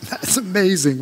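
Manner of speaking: slow, laughing fake wonder; imitating a polite stunned reply; mock amazement. Pastor answers the stranger in line, laughing mock wonder. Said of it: "I'm going".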